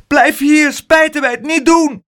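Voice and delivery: high, loud urgent call. A narrator's reading. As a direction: shout after him to stop. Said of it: "riep Pluk".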